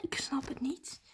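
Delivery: slow bewildered whisper; puzzled whisper. Real, not acted. Vlogger whispering confusion after the stranger stops. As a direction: breathe it out, confused.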